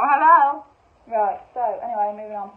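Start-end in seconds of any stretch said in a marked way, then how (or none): 0.0-0.7 louder, higher hello; delighted hello. Retold or imitated. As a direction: greet the yellow candle. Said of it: "It's like".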